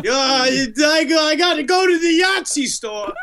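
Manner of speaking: high-pitched grumbling killer caricature; Son of Sam. Acted. Killer griping about his parking ticket, high cartoon voice. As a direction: complain in a silly, high put-on voice. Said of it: "I'm Son of Sam".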